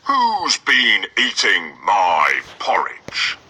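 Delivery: slow breathy whisper of a father bear; hushed, slow, suspicious papa bear; Father Bear finding his bowl eaten, slow and hushed. Acted. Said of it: "Father Bear looked in his porridge bowl and said".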